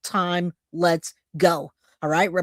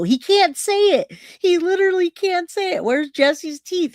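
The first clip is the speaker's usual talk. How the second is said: amused disbelief; 11 semitones higher, 4 dB louder than usual. Real, not acted. Cracking up at the anchor.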